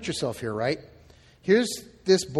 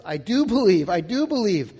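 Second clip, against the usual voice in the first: loud, higher-pitched outcry; crying out; 3 semitones higher, 6 dB louder than usual. Acted. Desperate father pleading with Jesus for his son, crying out. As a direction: cry it out with force, stressing the plea. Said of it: "the boy's father cries out".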